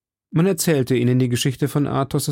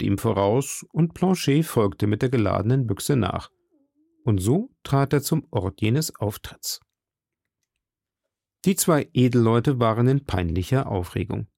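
plain reading voice

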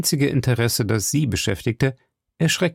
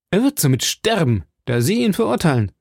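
firm, higher-pitched resolve; fester Ton; 6 semitones higher than usual. A narrator's reading. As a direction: state it firmly, without hesitation. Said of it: "in einem so festen Ton". (fest und entschlossen)

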